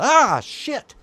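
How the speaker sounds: high, loud jolt of fright; acted scream of surprise, then swearing; startled yelp. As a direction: imitate someone jumping out of their skin. Acts out the startled bathroom visitor.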